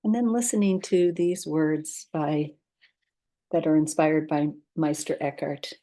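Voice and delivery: calm, unhurried guidance with long pauses; guided meditation. Acted. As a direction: lead listeners gently into rest, leaving space between instructions.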